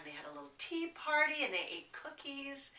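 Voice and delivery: usual speaking voice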